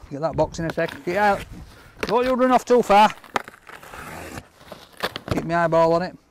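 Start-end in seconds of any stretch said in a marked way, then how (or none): none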